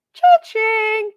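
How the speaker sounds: playful cha-ching, pitched high and loud; cash register cha-ching. Pretend. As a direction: ring it up like a till. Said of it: "No, I'm kidding".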